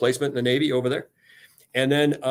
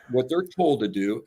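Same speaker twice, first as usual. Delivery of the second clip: ordinary delivery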